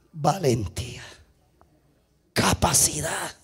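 low, soft, weighty single words; hushed solemnity. Real, not acted. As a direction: say each word low and apart. Preacher solemnly lists Job's virtues. (solemne, en voz baja y grave)

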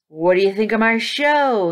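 showy, loud challenge to an audience; boastful villain. A narrator's reading. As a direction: show it off with swagger. Villain showing off, arms outstretched.

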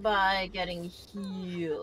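drawn out, teaching a beginner; slow, explaining. Shown as a demonstration. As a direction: say it slow, patiently. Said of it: "you can stop the bleeding".

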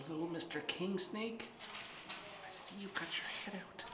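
soft, fast whisper to the snake. Real, not acted. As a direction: hush so the snake stays calm.

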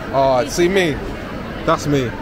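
ordinary talk, nothing marked

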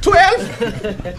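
very high, shocked twelve; incredulous echo. Retold or imitated. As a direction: squeak the word Twelve in disbelief.

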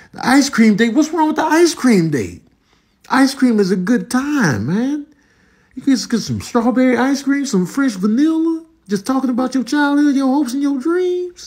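high-pitched, gushing excitement; excited enthusiasm. Real, not acted. Vlogger defending the ice cream date, excited. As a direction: get carried away, voice riding high.

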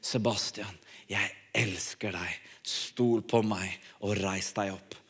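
a deep still voice speaking inside him; inner voice of God. Retold or imitated. God speaks to Sebastian in the meeting, low hushed voice. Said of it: "hørte jeg en stemme".

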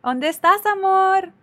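high, stretched-out lover's call; calling a sweetheart. Pretend. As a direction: call out for your lost love. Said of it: "I'd be like".

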